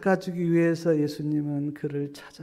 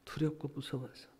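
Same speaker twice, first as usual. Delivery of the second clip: much softer, hushed repeat; softly; 10 dB quieter than usual. Acted. Preacher repeating 두렵고 무서워서 hushed for the congregation. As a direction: let it sink in, almost under the breath.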